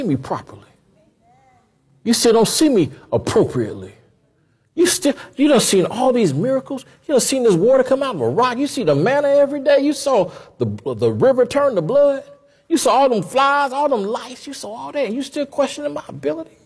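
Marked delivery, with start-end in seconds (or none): 4.7-16.6 higher, rising indignant listing of miracles; God piling up the proof against him; God scolding. Retold or imitated. God scolds Moses in sermon.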